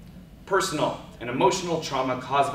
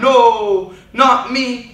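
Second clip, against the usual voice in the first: high, loud anguished cry; 9 semitones higher, 12 dB louder, much slower than usual. Acted. The man shot calling out, loud anguished cry. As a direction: fling it out as a desperate plea. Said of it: "I heard the bullet cry".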